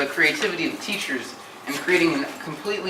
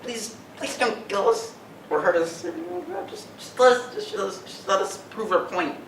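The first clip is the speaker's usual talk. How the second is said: high, desperate begging; frightened pleading; 5 semitones higher, 4 dB quieter than usual. Acted. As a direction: plead for your life. Begging the villain for mercy.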